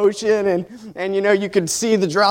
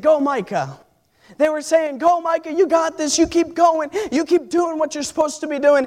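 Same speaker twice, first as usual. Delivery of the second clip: higher cheering chant; class cheering; 6 semitones higher than usual. Retold or imitated. Classmates cheering their friend through the last seconds. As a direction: cheer him on like a crowd. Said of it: "They said".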